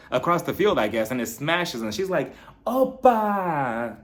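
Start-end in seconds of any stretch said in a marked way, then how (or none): 2.7-4.0 high, drawn-out whoop; celebratory cry. Retold or imitated. Angie throwing the drink across the field, celebratory cry. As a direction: call it out like a smashed-plate toast.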